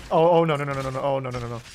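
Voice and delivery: panicked. Real, not acted. Spotted by many enemies.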